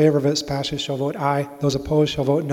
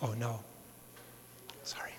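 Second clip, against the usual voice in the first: whispered apology; 4 semitones lower, 20 dB quieter than usual. Real, not acted. Member's slip during roll call.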